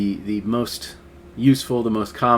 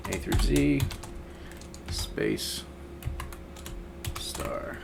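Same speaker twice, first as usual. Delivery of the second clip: muttering while typing; 8 dB quieter, slower than usual. Real, not acted. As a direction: murmur each word as you type it. Murmuring while typing a pattern.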